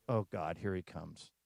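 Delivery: low, soft muttered dread; quiet, resigned aside in a retold quote. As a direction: grumble it under your breath, retelling a friend's dismay. Dreading aside about approaching colleague.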